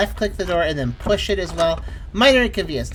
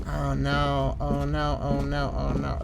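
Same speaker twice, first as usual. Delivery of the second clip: low, soft, monotone repetition; soft, sinking worry on one low note; low dread; 6 semitones lower, 6 dB quieter, slower than usual. Real, not acted. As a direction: mutter it low in resigned dread. Dreading trouble in the game.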